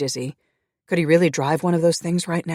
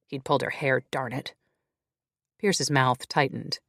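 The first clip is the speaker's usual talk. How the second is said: usual speaking voice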